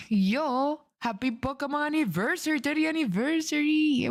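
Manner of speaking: excited cheer. Real, not acted. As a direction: cheer the anniversary. Celebrating anniversary with chat.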